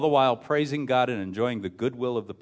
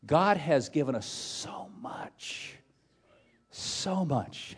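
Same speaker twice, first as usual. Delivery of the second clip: slow, soft, sinking towards a whisper; soft, heartfelt wonder; hushed awe; 5 dB quieter, much slower than usual. Real, not acted. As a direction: draw it out reverently, nearly whispering. Preacher moved by God's generosity.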